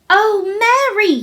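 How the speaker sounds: high, loud cry breaking toward a sob; half sob. Acted. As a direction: cry out on the edge of tears. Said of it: "he cried out with a half sob".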